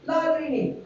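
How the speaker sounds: high Egyptian villager shrug, plainly repeated; clueless Egyptian villager patient again. Retold or imitated. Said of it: "they speak like this".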